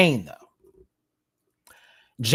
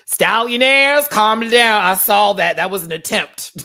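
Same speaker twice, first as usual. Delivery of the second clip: rally the chat with energy; excited call-out; 8 semitones higher, 10 dB louder than usual. Real, not acted. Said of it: "addressing chat, excited".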